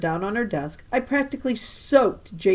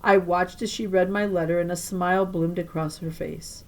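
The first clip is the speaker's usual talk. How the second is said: usual speaking voice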